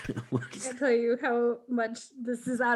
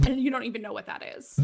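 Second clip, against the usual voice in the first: normal talking voice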